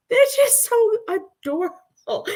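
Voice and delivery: high-pitched adoring squeal of words; adoring gush. Real, not acted. Fan telling a friend about the band, high adoring gush.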